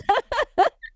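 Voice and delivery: sudden squeaky burst of laughter; high laugh. Real, not acted. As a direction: break into a high giggle. Teasing about the accent.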